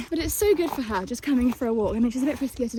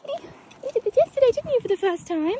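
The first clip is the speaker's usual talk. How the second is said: high, cooing pet voice, a bit louder; high cooing to horse; 10 semitones higher, 5 dB louder than usual. Real, not acted. Rider coos to her horse about yesterday's walk, high pet voice.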